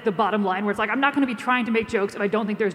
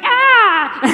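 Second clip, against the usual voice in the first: put-on high delighted baby squeal; 11 semitones higher, 8 dB louder than usual. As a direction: squeal like a happy infant. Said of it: "and she's like".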